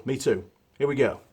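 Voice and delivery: usual speaking voice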